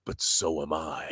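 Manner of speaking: low, soft, whispered menace of a quote; hushed film quote. Acted. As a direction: whisper it slowly, like quoting a thriller. Quotes a film line to open.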